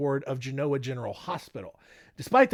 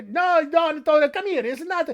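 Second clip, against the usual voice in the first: high, hammed-up Italian accent calling; Italian-accented aunt summoning the boy, mock stern; aunt calls Donato over in a high hammed Italian accent; 10 semitones higher, 4 dB louder than usual. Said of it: "I had to break out the Italian".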